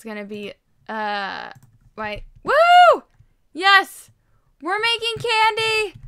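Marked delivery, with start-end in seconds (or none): 2.4-6.0 very high, loud whoop; excited cheer. Real, not acted. Gamer cheers about making candy, high and loud. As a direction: cheer like you won the lottery.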